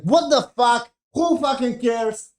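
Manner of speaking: high, loud outraged yell; retold scream. Viewer screaming at a boring scene, high outraged yell. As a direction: yell it at the screen in disbelief. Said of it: "I just screamed to myself".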